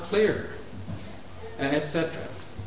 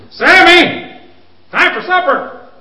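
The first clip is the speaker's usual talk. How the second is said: much louder and higher, getting impatient; louder call; 13 semitones higher, 11 dB louder, slower than usual. Shown as a demonstration. Parent calling child a second time, louder. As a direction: call him again, voice climbing. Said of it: "until your voice is way up here".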